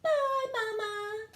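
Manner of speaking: put-on high, drawn-out kid's farewell; cried goodbye. Little aliens call goodbye to their mama, high and stretched. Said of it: "they cried".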